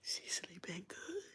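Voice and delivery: whispered aside, slow; hushed, slow conspiratorial whisper. Acted. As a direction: breathe it like a secret. Aside about [name].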